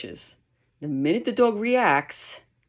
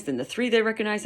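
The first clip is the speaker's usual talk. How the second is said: normal talking voice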